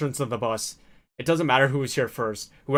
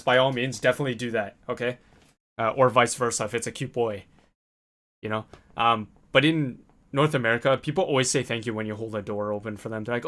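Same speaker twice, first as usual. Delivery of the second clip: usual speaking voice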